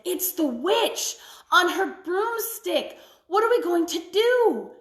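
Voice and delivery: put-on boy panicking as the witch nears; panicked child. A narrator's reading. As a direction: cry out in alarm. Martin panics.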